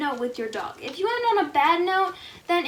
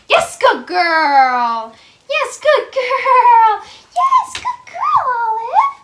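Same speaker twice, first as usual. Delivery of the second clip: high, loud, stretched-out praise; squeaky praising a dog; 7 semitones higher, 6 dB louder, much slower than usual. Real, not acted. Praising dog after trick.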